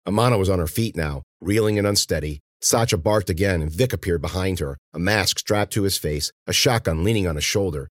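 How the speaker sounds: ordinary delivery, nothing marked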